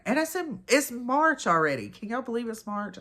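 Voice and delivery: high, disbelieving rise; can't believe it's March; surprised. Real, not acted. Disbelief at the date.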